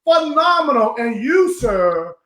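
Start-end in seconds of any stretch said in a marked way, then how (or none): 0.0-0.9 high, choked-up delight; reliving the thrill, voice cracking; excited. Retold or imitated. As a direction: gush like it overwhelms you. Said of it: "I'm like".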